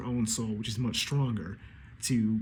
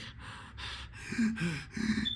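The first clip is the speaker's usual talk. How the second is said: a long unvoiced pant; heavy winded exhale; exhausted huffing; 6 semitones higher, 5 dB quieter than usual. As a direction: breathe out like after a marathon. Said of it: "Imu is huffing".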